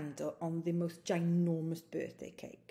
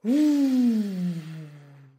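long loud sighing whoosh out; funny exhale noise; 9 dB louder than usual. Shown as a demonstration. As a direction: blow the air out in a big sigh. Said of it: "we're going to make this noise".

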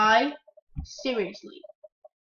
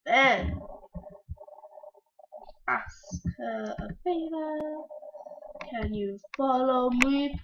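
ordinary delivery

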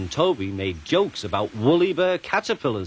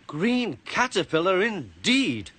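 slow, higher-pitched indignation; fuming; 8 semitones higher than usual. A narrator's reading. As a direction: draw it out in huffy offence. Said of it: "fumed Percy".

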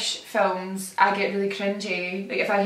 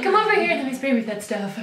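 put-on high, quick, laughing American accent; 5 semitones higher, faster than usual. Carrying on the joke, quick put-on American accent. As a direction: parody an American through laughter. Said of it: "put on my American accent".

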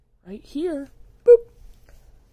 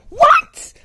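shocked shriek; 14 dB louder than usual. Acted. Shocked at news.

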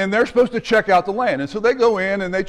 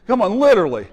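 ordinary talk

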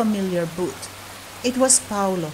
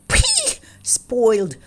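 disgusted; 3 semitones higher, slower than usual. Acted. Cat rejects spilled polenta.

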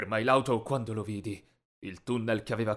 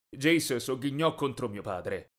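ordinary delivery, nothing marked

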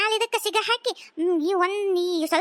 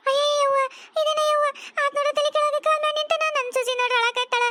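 put-on dismayed old mother; 4 semitones higher than usual. Mother-in-law exclaims in dismay.

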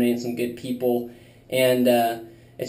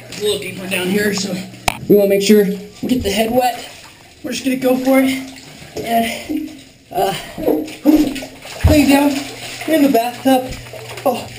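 in ice cold water; 6 semitones higher than usual. Real, not acted. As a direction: push words out through freezing water. Lying in ice bath.

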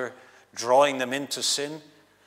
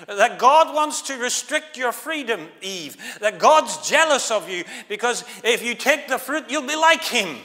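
high, loud serpent's persuasion; mocking voice of the devil; tempter's voice; 8 semitones higher, 6 dB louder than usual. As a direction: coax her like the tempter. Devil's tempting lie to Eve.

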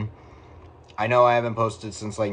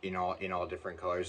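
ordinary delivery

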